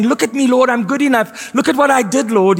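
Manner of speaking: a hint of a braggart; mock boasting believer. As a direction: show off to God like a proud child. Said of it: "Anything you wave at God saying".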